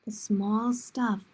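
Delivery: plain reading voice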